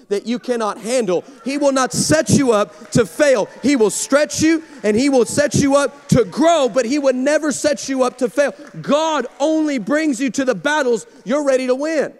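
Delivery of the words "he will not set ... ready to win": louder, higher preaching; full-force preaching. Acted. Pastor driving home the point to the congregation, preaching hard.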